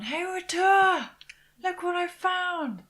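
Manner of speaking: high, drawn-out call; shout down. Acted. Player calls down to companion about a find, shouting. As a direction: call down loudly from above, stretched out. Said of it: "Well, I shout down".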